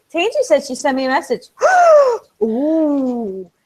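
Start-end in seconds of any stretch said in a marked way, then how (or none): 1.5-2.2 loud, high theatrical gasp; reenacted gasp. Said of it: "let me reenact what I did". 2.4-3.5 long impressed ooh, acted out; reenacted amazement. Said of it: "let me reenact what I did".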